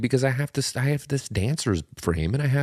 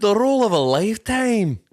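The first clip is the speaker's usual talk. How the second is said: high, loud movie-trailer boast; hammed-up hype; 11 semitones higher, 6 dB louder than usual. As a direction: sell it like a blockbuster. Mock trailer announcement.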